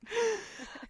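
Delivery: high laugh. Real, not acted. Loud laugh at reaction.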